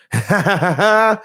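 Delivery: high cackling evil laugh; imitating evil laugh. Podcaster mimicking Sasha's laugh for viewers, high evil cackle. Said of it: "that's, like, her evil laugh".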